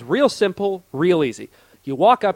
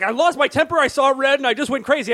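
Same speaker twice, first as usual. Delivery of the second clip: hotheaded boast; 7 semitones higher than usual. Retold or imitated. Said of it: "I get jealous of people who are like".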